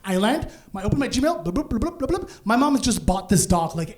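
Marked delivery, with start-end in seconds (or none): 1.5-2.3 rapid-fire rising bloops; imitated notification sounds. Opening Gmail to many alerts, high bubbly imitated pings. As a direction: burble little alert noises, climbing higher.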